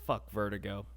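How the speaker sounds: ordinary delivery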